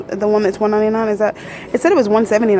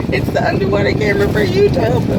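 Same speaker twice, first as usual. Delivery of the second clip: much higher, into a happy laugh; giddy excitement; 13 semitones higher, 5 dB louder than usual. Real, not acted. Vlogger presenting her new GoPro, giddy with excitement. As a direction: gush with delight until it breaks into laughter.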